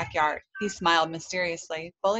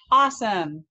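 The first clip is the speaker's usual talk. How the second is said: higher, louder exclamation; excited shout; 3 semitones higher, 7 dB louder than usual. A narrator's reading. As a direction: call it out like a thrilled kid. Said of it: "Bully shouted".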